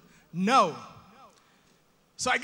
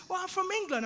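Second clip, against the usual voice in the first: high English lady voice; young English lady; 7 semitones higher, 3 dB quieter than usual. Acted. English lady telling where she is from, high voice. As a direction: answer lightly in a high English voice. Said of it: "this young English lady comes".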